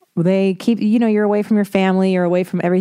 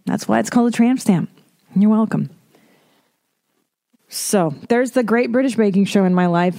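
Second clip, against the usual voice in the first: ordinary delivery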